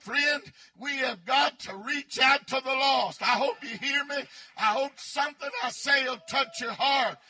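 loud, rising preacher's exhortation; fervent revival preaching; preaching at full force. Acted. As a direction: drive it home to the pews.

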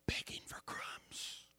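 hushed whisper, slow. Acted. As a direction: breathe the words out like a secret. Soft pleading image of puppies.

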